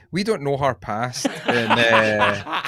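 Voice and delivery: mock earnest counsellor, laughing; playing the earnest counsellor; intervention voice. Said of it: "this is my intervention voice".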